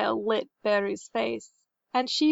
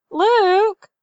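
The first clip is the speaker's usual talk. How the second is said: put-on high, loud call to a boy; 9 semitones higher, 7 dB louder than usual. A narrator's reading. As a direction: call the name from afar. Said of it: "someone called".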